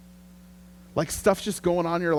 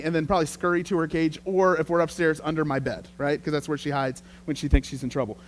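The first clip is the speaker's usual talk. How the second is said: normal talking voice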